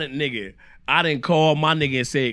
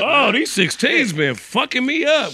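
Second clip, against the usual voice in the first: mock weary complaint; 8 semitones higher, slower than usual. Complaining about hours.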